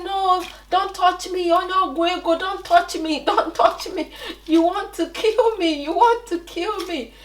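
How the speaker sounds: put-on high-pitched, panicky child's cry; retold frightened pleading. Terrified child pleading with her cousin, high and panicked. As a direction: relive the scared child backing away. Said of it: "And I said".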